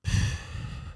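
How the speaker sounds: short, tense sigh blown out through pressed lips; demonstrating a client's stressed breath out. Shown as a demonstration. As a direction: show the patient's stressed exhale. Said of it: "and they'll go".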